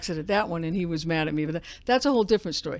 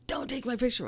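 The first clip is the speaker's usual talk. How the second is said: normal talking voice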